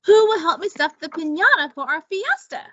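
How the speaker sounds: busy hostess asking for help; eager lizard. Acted. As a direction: rush the question, keen to get going. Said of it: "Iguana asked".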